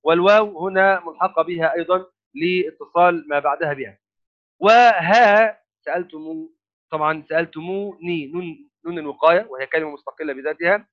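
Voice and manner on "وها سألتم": وها slowed and pushed louder; stress on waha. Shown as a demonstration. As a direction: drag out وها, sounding each part. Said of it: "وها هي أيضًا كلمة". (شدّد على "وها" ببطء وارتفاع)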